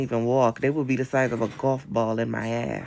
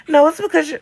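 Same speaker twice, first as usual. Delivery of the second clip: mock know-it-all; 14 semitones higher, 9 dB louder than usual.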